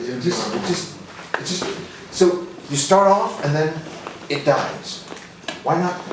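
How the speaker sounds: ordinary talk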